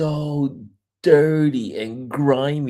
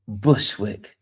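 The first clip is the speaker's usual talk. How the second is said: ordinary talk, nothing marked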